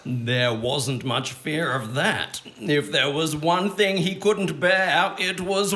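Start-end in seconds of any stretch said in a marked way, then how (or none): none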